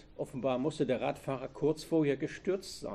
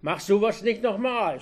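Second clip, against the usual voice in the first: angry yell, higher and louder; screaming; 7 semitones higher, 7 dB louder than usual. A narrator's reading. Robber warning Anke after she waved, screaming. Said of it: "schrie er".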